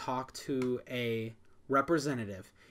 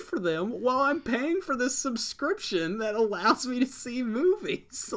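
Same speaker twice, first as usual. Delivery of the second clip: high, loud exasperated rant; angry venting; 11 semitones higher, 6 dB louder than usual. Real, not acted. Subscriber missing the films they want, exasperated rant. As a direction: pitch way up, pleading with frustration. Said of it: "I was that angry".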